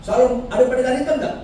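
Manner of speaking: high, fast mock visitor voice; visiting officer.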